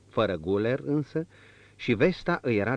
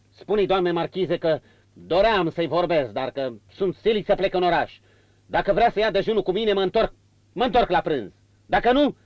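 1 stern marquis; 8 semitones higher, 6 dB louder than usual. A narrator's reading. Marquis voiced in story.

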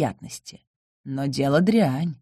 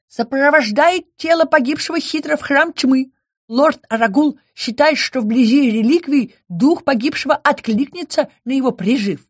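small creature voice; 8 semitones higher than usual. Acted. Nightmare creature speaking.

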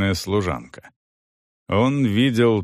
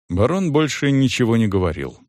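ordinary delivery, nothing marked

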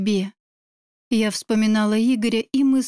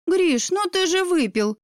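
higher-pitched reproach; scolding; 7 semitones higher than usual. Acted. Girl scolds Grisha for drinking, higher reproachful voice. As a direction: scold him in a lifted voice. Sister scolds drunk brother's friend.

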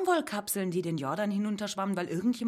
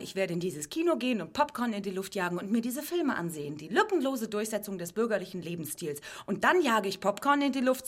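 ordinary delivery

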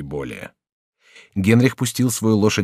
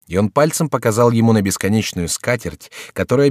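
ordinary delivery

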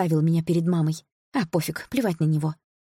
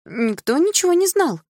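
very high, loud denial; flustered muttering; 11 semitones higher, 6 dB louder than usual. A narrator's reading. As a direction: deny everything in a high, evasive voice. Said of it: "бормотала Милана".

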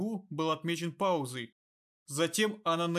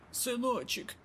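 put-on raspy old woman; 7 semitones higher, slower than usual. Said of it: "обратилась она к Кираю".

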